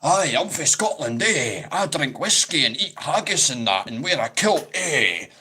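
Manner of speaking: loud, high, swooping mock-Scottish accent; bad Scottish accent. Vlogger mocking people who attempt the accent, hammed-up bad Scottish accent. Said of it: "tries to do a Scottish accent".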